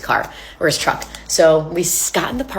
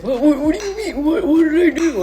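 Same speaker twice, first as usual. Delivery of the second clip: much higher, mocking confused whine; clueless boyfriend; 11 semitones higher than usual. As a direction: parody him acting innocent and baffled.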